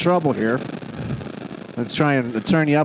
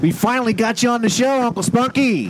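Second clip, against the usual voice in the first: excited welcome; 9 semitones higher than usual. Real, not acted. Host welcomes the caller on air.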